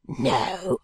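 low, rough-spoken working man, defiant; low-pitched, dialect-heavy, contemptuous brickmaker; surly drunken brickmaker. Acted. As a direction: growl out a sullen answer to an unwelcome visitor. Brickmaker's rant voiced within the narration.

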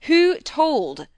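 loud, high, forceful demand; very stern. A narrator's reading. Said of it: "sterner than ever".

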